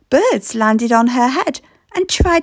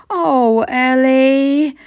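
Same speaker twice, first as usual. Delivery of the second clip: mild dismay; much slower than usual. Acted. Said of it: "they cried".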